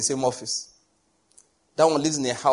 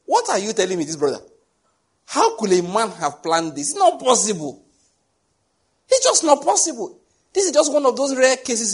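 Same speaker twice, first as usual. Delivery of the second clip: rising higher and louder into incredulous protest; retold disbelief; 7 semitones higher, 4 dB louder than usual. Preacher retelling his reaction to a brother's story, rising to disbelief. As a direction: build from puzzled to shocked disbelief. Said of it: "So I looked and I said".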